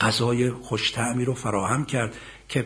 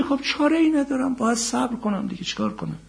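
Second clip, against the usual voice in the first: high, sighing resigned complaint fading off; grudging resignation; 13 semitones higher than usual. Acted. Preacher mocking a grudging believer, resigned sigh. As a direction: shrug it off reluctantly. Voicing the grudging patient man.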